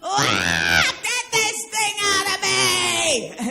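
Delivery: high, loud, slow anguished scream; screaming in labour. Retold or imitated. Mother recalling childbirth, screaming to get the baby out. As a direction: howl it like a woman mid-delivery. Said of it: "When I was having my kid".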